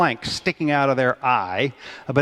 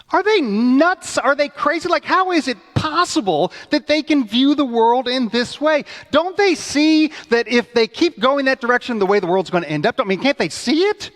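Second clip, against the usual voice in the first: much higher, louder disbelieving rant; exasperated partisan; 9 semitones higher, 4 dB louder than usual. Retold or imitated. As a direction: rant at the other party in disbelief. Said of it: "literally find yourselves going".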